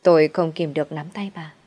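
ordinary delivery, nothing marked

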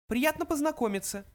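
high, small mouse voice; high-pitched little creature. A narrator's reading. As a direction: greet politely as a tiny mouse. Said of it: "сказал другой мышь".

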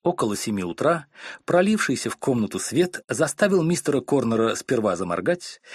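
ordinary delivery

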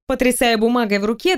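plain reading voice

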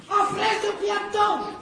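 put-on aunt scolding the boy for his lies; angry scolding. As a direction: tell him off sharply.

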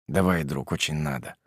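low, soft grumble into phone; grumbling. A narrator's reading. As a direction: mutter it down the line. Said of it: "буркнул в трубку".

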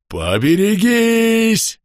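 протяжный, очень высокий и громкий крик; заорать во всё горло, предупреждая; истошный вопль. Acted. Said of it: "истошно завопил Клест". (истошно вопит)

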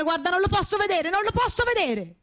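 furious outburst. Acted. Widow cursing the family friend, furious. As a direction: voice climbing high in fury. Widow ranting about the cretin.

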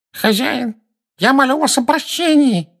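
high, nasal, begging whine; nasal pleading servant. A narrator's reading. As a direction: grovel through the nose. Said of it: "прогнусавил человек". (гнусавый умоляющий голос)

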